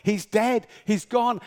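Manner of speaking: normal talking voice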